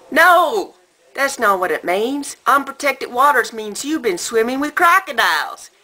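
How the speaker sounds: put-on high, loud, exasperated Southern accent; hammed-up Southern accent, shouted warning; American Southern accent. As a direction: holler it like an alarmed Southern dad. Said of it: "this is my best American Southern accent".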